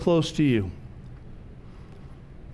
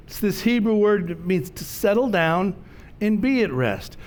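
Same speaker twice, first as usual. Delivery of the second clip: normal talking voice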